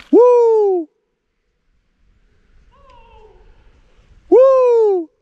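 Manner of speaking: loud, high echoing whoop. Real, not acted. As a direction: yell it across the canyon. Shouting echoes into canyon.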